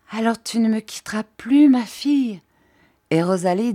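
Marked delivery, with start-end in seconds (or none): 0.1-2.4 high, moved, pleading question; trembling with emotion. A narrator's reading. Jeanne hoping her servant will stay, deeply moved. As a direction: ask it raised in pitch, overcome. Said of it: "tremblant d'émotion, murmura".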